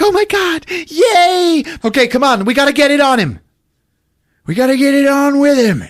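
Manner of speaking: very high, gleeful squeal; hammed-up excitement. Acts out celebrating and urging on.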